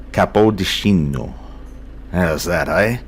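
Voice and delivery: slow, hammed-up Italian on Capodicino; mock Italian accent. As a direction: finish the route with a flourish of fake Italian. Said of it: "sounded vaguely European, that accent".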